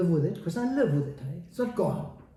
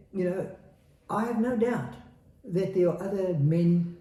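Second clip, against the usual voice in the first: ordinary talk, nothing marked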